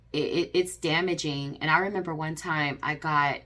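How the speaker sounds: ordinary delivery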